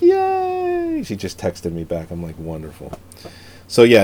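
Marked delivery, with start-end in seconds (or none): 0.0-1.0 high-pitched, drawn-out mock cheer; imitating wife's delight. Imitating wife texting back about Brooklyn, mock-delighted. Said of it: "she's like". 2.4-2.9 very soft, low, unenthusiastic; mock resigned. Husband answering wife's cheer about the film, mock-resigned.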